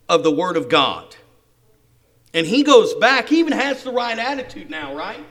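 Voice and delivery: loud, emphatic sermon climax; forceful preaching. Real, not acted. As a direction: proclaim it to the congregation with conviction.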